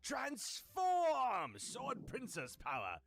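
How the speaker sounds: put-on a magical hero girl announcing herself and crying out; heroic transformation call.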